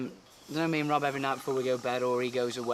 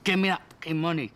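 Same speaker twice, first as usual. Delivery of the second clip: mock drunk anger; 2 semitones higher, 5 dB louder than usual. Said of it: "Rob turns angry".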